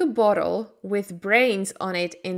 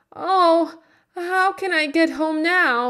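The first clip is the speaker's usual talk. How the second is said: higher, louder, a mild hint of tears; crying; 9 semitones higher, 4 dB louder than usual. A narrator's reading. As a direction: plead through light tears. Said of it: "Dorothy began to cry".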